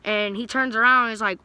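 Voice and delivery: usual speaking voice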